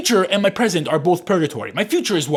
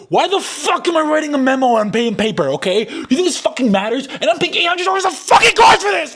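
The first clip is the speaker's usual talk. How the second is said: furious shouting, much higher and louder; angry rant; 13 semitones higher, 6 dB louder than usual. Real, not acted. Student venting at the camera, furious shout. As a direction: blow up at the absurd assignment. Ranting about pen-and-paper memo.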